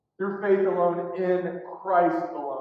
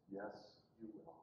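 very low, very soft reply; hushed, grave answer; quiet certainty; 10 semitones lower, 28 dB quieter than usual. Retold or imitated. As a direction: contradict gently, almost inaudibly. Jesus's words answer the protests.

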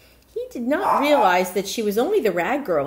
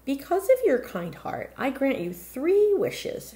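put-on old magical woman; 7 semitones higher than usual. Old woman grants wishes.

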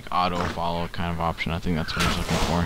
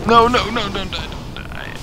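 high, frantic no no no; panicked pleading; 13 semitones higher than usual. Real, not acted. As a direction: beg the character not to die. Character about to die in game.

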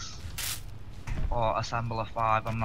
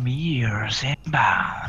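breathy computer voice; much slower than usual. Retold or imitated. Mocking the ship computer voice.

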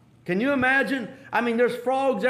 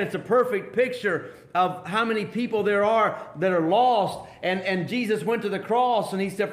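normal talking voice

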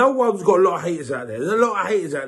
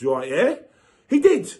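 ordinary delivery

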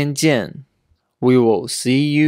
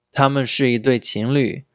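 ordinary delivery

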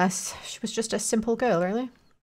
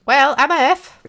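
higher, louder mock shout; loud exclamation; 4 semitones higher, 9 dB louder than usual. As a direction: belt it out as a joke. Shouting joke.

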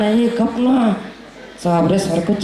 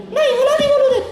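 much higher bossy echo; copying puppet; faster than usual. Acted. The puppet fights to talk first in a much higher voice. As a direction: demand to speak first, puppet voice.

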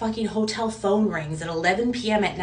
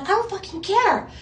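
much higher, indignant fury; retold exasperated outburst, pitched way up; angry outburst; 11 semitones higher than usual. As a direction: snap it back at the kitchen guy. Snapping at kitchen staff.

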